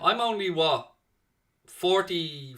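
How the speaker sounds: normal talking voice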